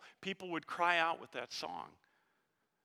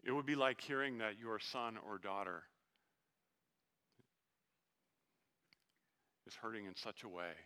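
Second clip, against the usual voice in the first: tender sermon hush; 6 semitones lower, 9 dB quieter than usual. Acted. Preacher telling the congregation of Jesus' tears, hushed and tender. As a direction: draw them close and speak gently. Tender quiet passage on weeping.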